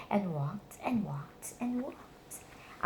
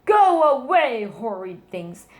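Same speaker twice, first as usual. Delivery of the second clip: put-on a louder, higher snap at the kids; grouchy old mouse shooing children off; grumpy shout; 4 semitones higher, 9 dB louder, slower than usual. A narrator's reading. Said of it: "he would shout".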